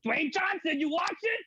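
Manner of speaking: called out high across the room; a high mock yell at a celebrity. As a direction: shout it up high to get attention. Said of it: "I kept yelling out".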